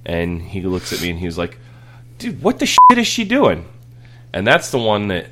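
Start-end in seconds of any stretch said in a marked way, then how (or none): 2.2-3.7 much higher, louder startled question; baffled kid. Retold or imitated. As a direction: sound totally bewildered. Son asks about peeing dog.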